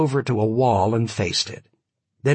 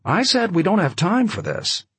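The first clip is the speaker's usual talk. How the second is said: cool, higher, commanding; frosty commanding; 9 semitones higher than usual. A narrator's reading. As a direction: order him like a cold aristocrat. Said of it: "lion's tone of cool command".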